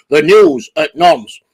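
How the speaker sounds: slow, low announcer voice; slow, hammed-up anchor intro; mock newsreader. Announces mock news show.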